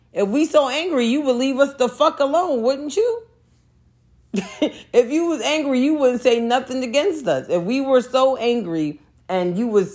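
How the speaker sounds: indignant pitch rise. Real, not acted. Indignant rhetorical challenge to critics.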